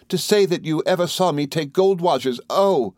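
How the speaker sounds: high, indignant protest; Dora, shocked exclamation. A narrator's reading. Wife wrongly feels accused of theft, high indignant exclamation. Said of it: "exclaimed Dora".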